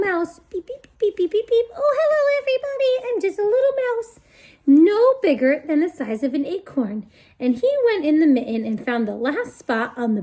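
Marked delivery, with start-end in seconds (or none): 0.5-1.7 high little squeaky beeps; mouse squeaks. Acted. 1.8-7.1 high, fast little mouse voice; tiny mouse voice. Acted.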